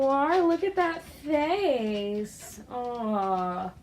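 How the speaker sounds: high, sing-song cooing at a pet; pet talk. Real, not acted. Owner cooing to her cat eating, high pet voice.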